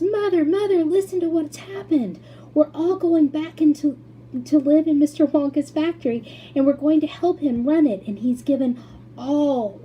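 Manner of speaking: high, loud tumbling words; too thrilled to get it out; breathless excitement. A narrator's reading. Charlie blurting the news to his mother excitedly.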